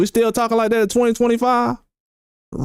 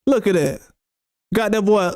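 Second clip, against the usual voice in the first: ordinary delivery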